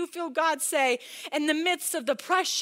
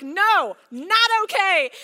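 loud, slow, firm refusal; 4 semitones higher, 9 dB louder, slower than usual. Retold or imitated. Mom to husband, loud slow no. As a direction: drag out a loud no. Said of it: "And I'm like".

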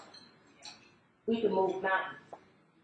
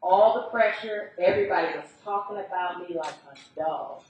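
ordinary talk, nothing marked

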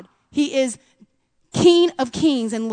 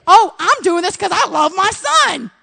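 mock self-justifying; 6 semitones higher, 5 dB louder than usual. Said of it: "self-justifying mom".

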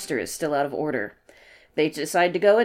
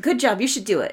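supportive friends voice; 6 semitones higher than usual. Retold or imitated. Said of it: "They're like".

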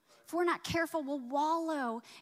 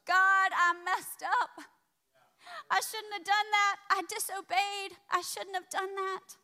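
repentant plea; 5 semitones higher, 3 dB louder than usual. Retold or imitated. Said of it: "He goes before the Lord repentant".

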